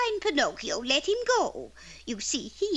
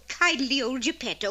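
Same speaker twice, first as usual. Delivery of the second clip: ordinary talk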